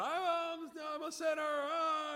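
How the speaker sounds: high, loud hammed-up wail; mock a man crying out in torment; over-the-top yelled confession; imitated yelling. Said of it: "he's just yelling and yelling".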